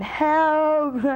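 high loud cry out of the window; calling for help. Retold or imitated. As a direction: shout it down to the street below.

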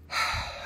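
long audible sigh; deep breath. Real, not acted. Seller calming himself mid-show, deep sigh. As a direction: let out a big breath to settle. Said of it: "take a deep breath".

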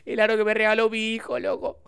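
high, hammed-up lament; mock-tragic mother. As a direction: imitate a mother wailing over a tragedy. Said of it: "era como una tragedia".

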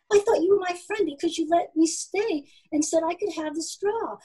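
high, pleading rush of words; anxious outpouring. A narrator's reading. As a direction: pour it out in a worried little voice. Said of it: "The words poured out because Oscar was afraid".

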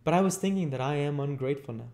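put-on high, hurried boy's voice; earnest little boy. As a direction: confess fast as a worried child. Voices Oliver in the story.

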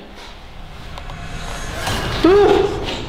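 sudden high frightened gasp; real startled gasp; sharp gasp. Real, not acted. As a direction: gasp as something flies past your head. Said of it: "I'm petrified of them".

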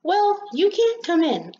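high, firm little rosebud; indignant refusal. Acted. Rosebud turns the rain away at the door, indignant.

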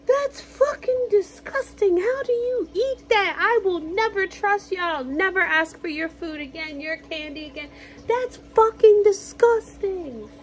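high-pitched, slowed imitation of a grossed-out friend; mock disgusted rant. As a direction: ham up his horror at her food.

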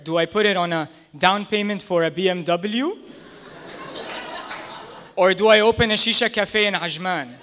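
ordinary talk

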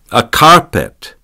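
slow, loud imperious order; commanding. A narrator's reading. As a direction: bark it as a command. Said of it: "she commanded".

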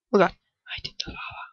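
whispered and fast; very soft fast whisper; panicked whisper. Real, not acted. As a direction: hiss it out in panic. Panicked aside.

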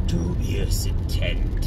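slow villain line breaking into evil laughter; dark villain. Shown as a demonstration. Man playing someone with dark talents, villainous cackle. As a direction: relish it slowly, then cackle. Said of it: "dark, dark talents".

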